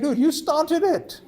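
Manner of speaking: high, drawn-out indignant protest; exasperated acted comeback, stretched out; indignant retort. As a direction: throw the blame back, pitched high and loud. Acted quarrel, protesting to vendor.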